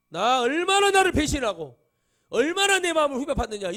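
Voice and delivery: loud, high outcry of betrayal; wounded reproach. Acted. God to those who betrayed him, anguished shouted reproach. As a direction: cry out as a deeply hurt, angry God. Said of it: "이렇게 드려야 되거든요".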